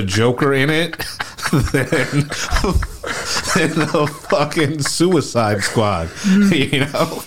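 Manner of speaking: laughing through the words; chuckling while finishing the joke. Real, not acted. Joke about Joker screen time.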